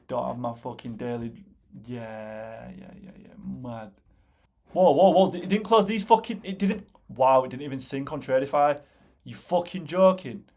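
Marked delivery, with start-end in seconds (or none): 1.9-3.9 muttered under breath. Real, not acted. Muttering to himself. 4.8-10.4 alarmed shock. Real, not acted. Trade did not close.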